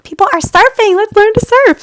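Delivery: high, loud excited retold outburst; rally your sister with a high, loud call; excited urging.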